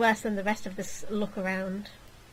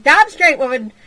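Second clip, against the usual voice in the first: loud emphatic; 5 semitones higher, 11 dB louder than usual. Real, not acted. Emphatic agreement.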